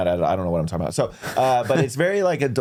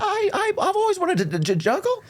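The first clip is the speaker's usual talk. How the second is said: eager interviewee. Retold or imitated. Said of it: "in a job interview goes like".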